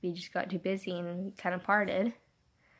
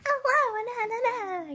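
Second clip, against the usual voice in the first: usual high voice; 14 semitones higher, slower than usual. Shown as a demonstration. Showing viewers her normal high voice while sick. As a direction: pitch way up into her usual chirpy voice. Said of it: "when I'm usually like".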